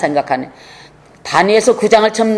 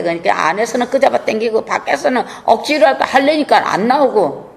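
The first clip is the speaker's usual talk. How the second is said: ordinary delivery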